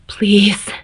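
loud, ragged plea. A narrator's reading. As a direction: let the voice fray on it. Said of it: "His voice grew ragged".

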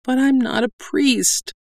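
high, pleading protest; abject. A narrator's reading. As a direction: whine it helplessly. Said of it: "Purchase Doll said abjectly".

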